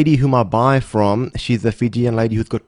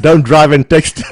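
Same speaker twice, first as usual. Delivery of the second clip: slow, laughing through it; can't keep a straight face; 4 semitones higher, 6 dB louder, slower than usual. Real, not acted. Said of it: "I can't keep a straight face".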